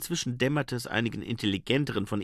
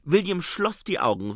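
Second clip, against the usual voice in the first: ordinary delivery, nothing marked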